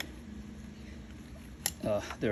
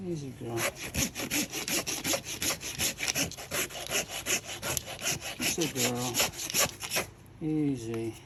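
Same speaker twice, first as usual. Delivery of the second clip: soothing a horse; 4 dB quieter than usual. Real, not acted. Calming the horse during shoeing.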